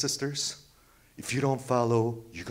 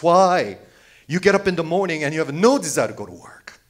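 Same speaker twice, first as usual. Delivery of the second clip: louder, higher and faster, pressing the point; sermon shout; 5 semitones higher, 10 dB louder than usual. Real, not acted. Preacher pressing listeners about dreading work, loud. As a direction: hammer it home at the congregation.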